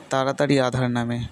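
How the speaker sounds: usual speaking voice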